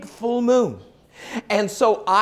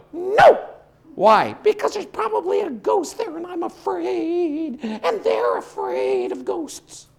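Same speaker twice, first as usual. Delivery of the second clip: scared horse refusal; 9 semitones higher, slower than usual. Acted.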